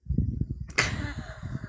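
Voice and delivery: soft, wheezy laugh; breathy voiceless laughing fit; real laughter. Real, not acted. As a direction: crack up almost silently. Laughs after own complaint.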